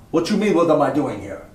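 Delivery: mock Jamaican accent thrown back at the cop. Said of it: "says to him with a heavy Jamaican accent".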